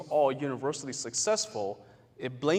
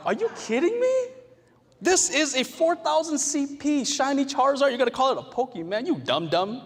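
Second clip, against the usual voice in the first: much higher, louder outraged scoff; indignant gamer; 11 semitones higher, 5 dB louder than usual. Pretend. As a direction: scold a clueless player, appalled.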